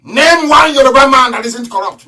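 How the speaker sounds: loud, high challenge; a shouted dare; challenging shout. Real, not acted. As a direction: dare them to answer. Challenging the Yoruba.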